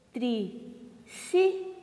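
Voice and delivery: TRISSÍLABA split into tri, sí, la-ba with long pauses; syllable by syllable. Shown as a demonstration. Teacher shows pupils the term, "Trissílaba" syllable by syllable. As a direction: say "Trissílaba" slowly, piece by piece. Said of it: "Ó o acento, hein?".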